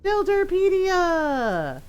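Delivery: high, long-drawn mock fanfare on the name; playful call. Looking for the recipe book, playful drawn-out call. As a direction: stretch it out high, falling at the end.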